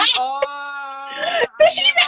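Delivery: high cackling laughter. Real, not acted. Cracking up at the NPR joke with a shrieking laugh. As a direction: burst out laughing hard.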